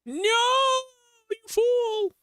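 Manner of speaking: high, hammed-up cry of despair; shrill theatrical "you fool"; mock-dramatic scolding. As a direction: cry out like a melodramatic villain. Scolds himself after dying.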